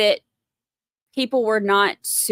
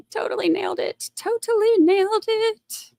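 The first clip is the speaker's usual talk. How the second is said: high, soft sing-song boast; mock self-praise; 11 semitones higher, 3 dB quieter than usual. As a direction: congratulate yourself in a high, silly voice. Mock self-praise after accent attempt.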